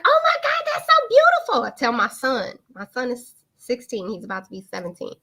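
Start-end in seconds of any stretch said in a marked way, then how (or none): 0.0-1.6 very high, loud imitated squeal; hammed-up high-pitched delight; gushing friend. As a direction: gush like a thrilled girlfriend. Friend's gushing reaction acted out.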